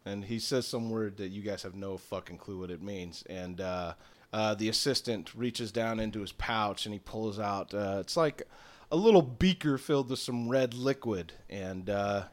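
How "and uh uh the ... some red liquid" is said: usual speaking voice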